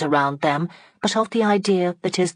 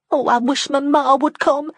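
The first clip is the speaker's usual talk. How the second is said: high, fretful plea; anxious; 10 semitones higher, 5 dB louder than usual. Acted. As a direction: wring it out anxiously. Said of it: "wringing her hands".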